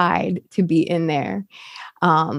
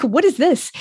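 incredulous; 7 semitones higher than usual. Retold or imitated. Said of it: "I'm like".

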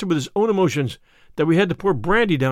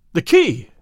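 sudden loud burst of surprise; startled exclamation; 6 dB louder than usual. A narrator's reading. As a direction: blurt it out, startled. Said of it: "ejaculated Phelps".

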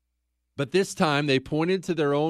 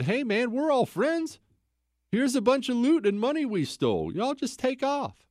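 high, breezy bribe pitch; mock-friendly high sweet-talk; smooth-talking buddy; 12 semitones higher than usual. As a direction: act the friendly fixer sliding them loot. Buddies bribing the Cumans.

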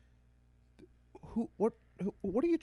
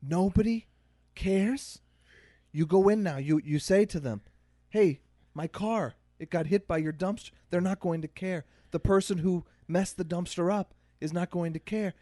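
lightly voiced Scottish-accented old man, pitched higher; a hint of Scottish accent, pitched up; 6 semitones higher than usual. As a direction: imitate an old man's faint Scottish lilt, halting between phrases.

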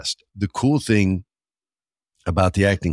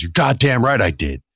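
retold angry retort; 7 semitones higher, 3 dB louder than usual. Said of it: "He goes".

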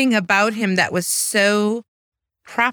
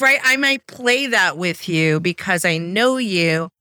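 usual speaking voice

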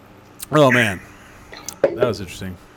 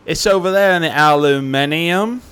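attempted Australian accent; 5 semitones higher than usual. Host quoting the cobra-heart seller, jokey attempt at Australian accent. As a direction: play up a questionable Australian accent. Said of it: "I don't know what accent that was".

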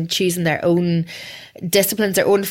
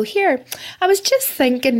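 higher, slow, sing-song casual mum; breezy mum opener; 7 semitones higher, slower than usual. Retold or imitated. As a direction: pretend to be casually bringing something up. Mum casually opening chat in car.

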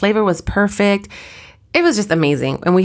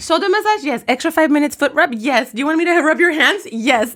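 eager yes; 8 semitones higher than usual. Retold or imitated. Recounting eager yeses.

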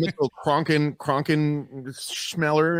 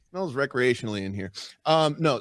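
ordinary talk

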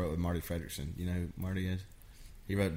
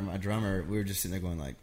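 ordinary talk

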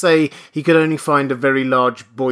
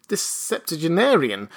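plain reading voice

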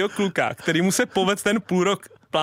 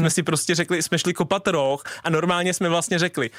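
ordinary delivery

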